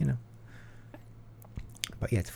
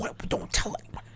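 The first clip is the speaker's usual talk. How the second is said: hushed, voiceless whisper; mock secretive whisper. Hushed quote of secret Instagram post.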